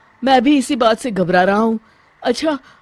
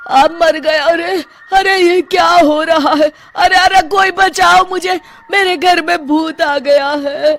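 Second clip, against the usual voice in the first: higher, louder frantic yelps; panicked cry for help; 7 semitones higher, 4 dB louder than usual. Acted. Sarpanch crying out as the invisible ghost beats him. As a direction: scream for someone to save you.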